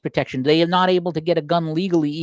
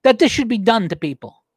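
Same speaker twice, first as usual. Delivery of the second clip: getting upset; 5 semitones higher than usual. Real, not acted. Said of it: "I'm sorry if I'm getting upset".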